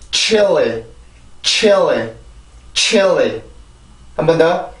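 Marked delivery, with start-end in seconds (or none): 0.1-3.4 chilly as a gliding chi-eo-li, falling; pronunciation demo. Shown as a demonstration. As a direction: say chilly with CH flowing through each vowel in turn. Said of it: "이렇게 발음이 돼요". (chilly를 '치얼리'처럼 부드럽게 이어서 발음)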